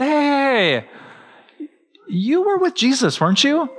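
high-pitched, drawn-out "hey" of recognition; suspicious bystander. Acted. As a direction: call him out as if just recognizing him. Acts out bystanders accusing Peter.

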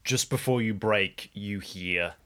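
normal talking voice

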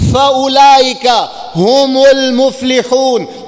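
fast chanted Arabic verse; quick Quranic recitation. Acted.

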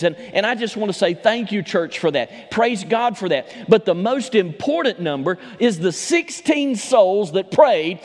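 rising Southern preacher's fervor; preaching. Acted. Pastor preaching to his church, full fervor. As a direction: build the message toward the altar call.

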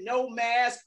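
ordinary delivery

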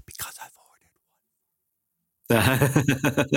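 playful secretive whisper. Acted. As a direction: hush it like a secret. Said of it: "I have to whisper on this".

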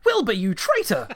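hammed-up villain shouting at her traitor rat; outraged villain.

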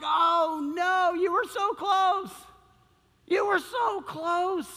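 high, drawn-out lament; stretched, high-pitched cry of dismay; anguished disappointment. Acted. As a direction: plead slowly in pained disbelief. Imagined onlooker lamenting a near miss.

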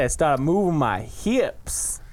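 slow, drawn-out film impression; Forrest Gump. Host demonstrating how the character talks, slow drawl. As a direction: stretch each word in a simple drawl. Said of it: "That's how Forrest talks".

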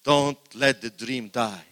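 each word slow and loud; slow loud exhortation. Real, not acted. Preacher urging the church to hold dreams, slow and loud. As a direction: drive it home slowly.

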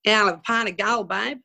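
put-on broad Australian pub voice; heavy Australian accent. Bar customer ordering a Gull from the barmaid, heavy Australian accent. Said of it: "they had that heavy Australian accent".

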